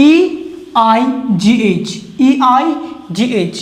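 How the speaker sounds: ইআইজিএইচ spelled letter by letter, very slowly; slow classroom spelling of ইআইজিএইচ. Shown as a demonstration. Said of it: "এই দেখো".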